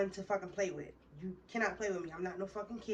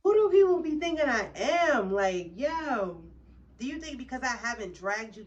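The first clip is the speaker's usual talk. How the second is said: loud, high indignant rant; outraged; 4 semitones higher, 10 dB louder than usual. Real, not acted. Ranting to viewers about being tried, loud outrage. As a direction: let the outrage burst out.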